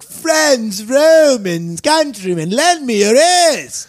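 put-on milk every word in a hammy British accent; over-the-top British accent. Student reading Shakespeare aloud to class, over-the-top British accent. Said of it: "weird ass, over the top British accent".

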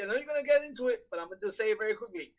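fast rushed delivery; talking very quickly. Shown as a demonstration. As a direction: rattle it off quickly. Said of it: "Talking very quickly".

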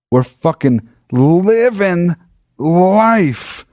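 high, slow, drawn-out triumph; hammed-up elation. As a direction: stretch each word in mock-epic jubilation. Same triumph repeated, hammed up.

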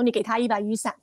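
usual speaking voice

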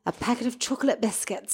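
quick, soft Irish-accent recital; college-monologue Irish accent. Shown as a demonstration. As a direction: toss it off in a put-on Irish accent. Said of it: "that was my Irish accent monologue in college".

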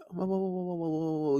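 very soft, running string of whoas; calm cut-in. Acted. Man cutting off his upset girlfriend, soft and calm. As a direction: gently talk over someone to settle them. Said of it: "but, but be calm".

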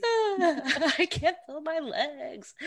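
high, slow, laughing till crying. Real, not acted. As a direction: giggle helplessly through it. Drunk giggling fit.

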